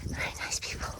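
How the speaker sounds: breathless, voiceless near-whisper; words trailing into air, really out of breath. Real, not acted. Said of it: "I'm out of breath".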